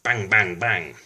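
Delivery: punchy bang, bang, bang; banging noise. Acted. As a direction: hit each bang like a hammer blow. Builders banging on the roof.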